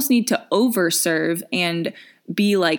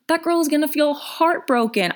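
indignant; 8 semitones higher than usual. Real, not acted. Indignant reaction to bad pitch.

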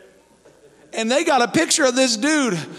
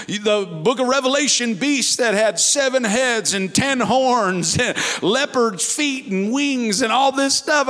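usual speaking voice

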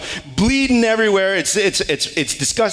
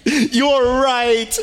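high, hammed-up fake wail, laughing through it; mock wailing along; 8 semitones higher than usual. Father mocking his crying son by wailing along, laughing. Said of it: "I wailed with him".

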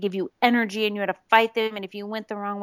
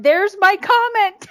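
high, loud, thrilled announcement; giddy retold excitement, bursting out; excited fangirl squeal; 11 semitones higher, 7 dB louder, slower than usual. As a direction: draw it out high with fan excitement. Retelling her fan excitement.

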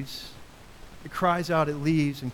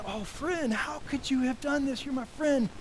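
hurt reproach; 8 semitones higher than usual. Pretend. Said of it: "To your friend".

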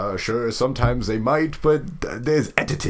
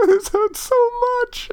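laughing in pain. Real, not acted.

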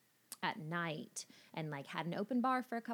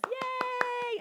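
excited cheer; 8 dB louder than usual. Real, not acted. Celebrating green card news.

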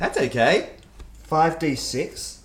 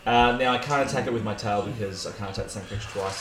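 ordinary delivery

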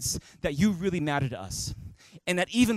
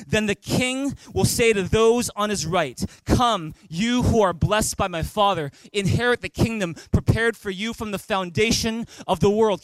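ordinary talk, nothing marked

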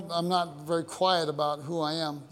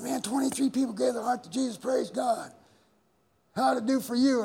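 higher, bragging tone; proud excited report; 9 semitones higher than usual. Retold or imitated. Pastor proudly telling his wife on the phone, higher excited voice. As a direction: boast of the crusade results brightly. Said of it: "I said".

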